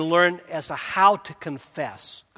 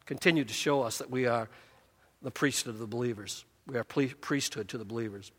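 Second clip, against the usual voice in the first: much softer, lower murmur; quiet aside; 6 semitones lower, 9 dB quieter than usual. Real, not acted. Pastor reading softly to the church, hushed. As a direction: drop to a hush.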